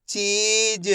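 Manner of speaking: चीज with long ee and light final j; half-sounded last letter. Shown as a demonstration. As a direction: hold ची, then release only half of ज in चीज. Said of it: "last वाले अक्षर का उच्चारण अपन आधा करेंगे".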